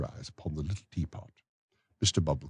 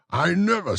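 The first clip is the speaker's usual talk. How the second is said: a higher, self-important parish beadle, louder; pompous beadle voice; 13 semitones higher, 7 dB louder than usual. Acted. Beadle to the matron, pompous higher voice. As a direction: lecture the matron grandly on the weather. Bumble speaks.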